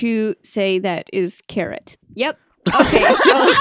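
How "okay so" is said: laughing. Real, not acted. Bursts out laughing.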